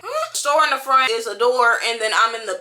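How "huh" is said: surprised huh. Retold or imitated. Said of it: "I'm like".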